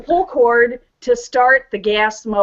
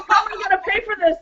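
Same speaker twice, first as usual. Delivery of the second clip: rushed mock panic; 8 semitones higher, much faster than usual. Laughing at the bill.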